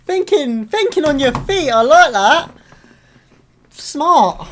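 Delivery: loud, high-pitched whooping praise; real excited cheer. Real, not acted. Viewer applauding Leia's quick thinking, loud excited cheer.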